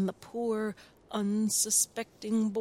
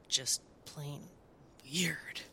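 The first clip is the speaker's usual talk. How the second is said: hushed; 4 semitones lower, 8 dB quieter, slower than usual. A narrator's reading. Narrator hushes for the weird monster, whispered.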